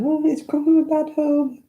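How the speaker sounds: high, mock-pitying excuse. Mocking today's soft excuses.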